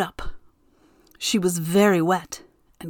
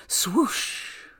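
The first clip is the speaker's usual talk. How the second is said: wind whoosh; 5 dB quieter than usual. A narrator's reading. Narrator voices the wind.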